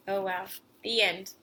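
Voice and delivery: usual speaking voice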